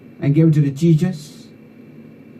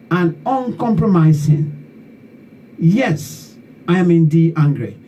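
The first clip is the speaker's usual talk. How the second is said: slow, higher-pitched declaiming with indignant repetition; angry poem recital; 4 semitones higher than usual. Acted. Poet reading own poem to those who betrayed Liberia, indignant declamation. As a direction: recite an indignant protest poem, drawing out key words.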